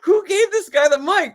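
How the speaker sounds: high, loud incredulous cry through laughter; helpless laughing exclamation; laughing disbelief. Retold or imitated. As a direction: crack up while retelling your outrage. Retelling his disbelief while laughing.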